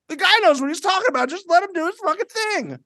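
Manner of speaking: high, fast exasperated inner voice; rushed, fed-up plea to let him lead; exasperated thought. Retold or imitated. Retelling his frustration at housemates to the guest, high and fast.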